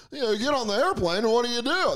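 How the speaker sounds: high, hammed-up old-school comic delivery; eighties stand-up voice. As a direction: do a corny eighties club comic.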